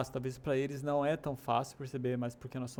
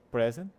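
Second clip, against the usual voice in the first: "present" as PREZ-ent, punched louder; first-syllable stress; 6 dB louder than usual. Shown as a demonstration. Teacher shows the gift noun, "present" stressed up front. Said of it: "A ênfase tá no começo".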